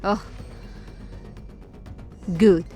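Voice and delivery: ordinary talk, nothing marked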